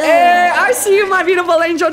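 high, loud gushing greeting; excited shout-out. Real, not acted. Streamer to viewer who gifted a sub, excited shout-out. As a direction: call out in delight to a supporter.

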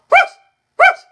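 very high, loud puppy yaps; squeaky little-dog barks; high yappy barking. Shown as a demonstration. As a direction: yap like a tiny dog on cue. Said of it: "Come on, bark for me".

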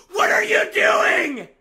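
slow, high, very loud yell; drawn-out outraged shout; furious shout. Real, not acted. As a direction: explode at the teammate's blunder. Teammate blunders, streamer erupts.

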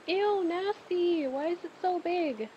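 high, drawn-out disgust; grossed out. Real, not acted. Player reacting to a big bug in the game, grossed out. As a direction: recoil with a high, squeamish whine.

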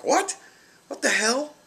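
high, loud shocked outburst; imitating mom's stunned what the hell; mother's shock. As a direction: blurt it out in disbelief.

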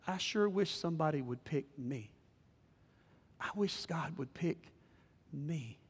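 soft, subdued wish; quiet, left-out yearning; wistful longing. Acted. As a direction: trail down softly, longing to be picked. Acting wistful longing to be picked.

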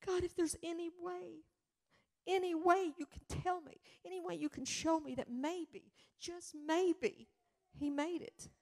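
high, pleading prayer voiced for the mother; desperate prayer. Grieving mother praying over her son's ashes. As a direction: beg God for a sign. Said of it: "And I prayed".

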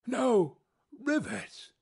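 can't believe his ears, jumping up; disbelieving exclamation. Acted. Boiler-maker to narrator, a high cry of disbelief.

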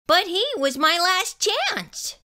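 ordinary delivery, nothing marked

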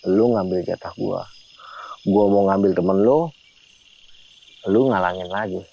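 low, slow retold menace; mildly raging old woman. Old woman spirit confronts the narrator over her share, understated low anger. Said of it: "itu si nenek-nenek ngamuk".

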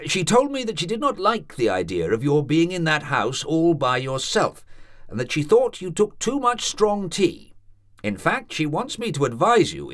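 a friendly college man giving gentle advice; kindly old doctor. A narrator's reading. Doctor advising the young student, lightly voiced.

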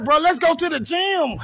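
usual speaking voice

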